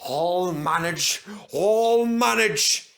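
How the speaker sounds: slow wheezy, struggling on; wheezing. Acted. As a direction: wheeze it out, straining. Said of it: "he wheezed".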